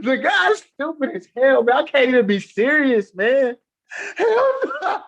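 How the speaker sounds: laughing hard. Real, not acted. Still laughing at the comment.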